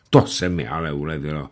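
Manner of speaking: ordinary delivery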